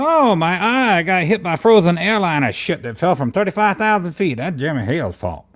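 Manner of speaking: high, loud mocking voice of a complainer; mock someone blaming everything on Jeremy; mocking whine.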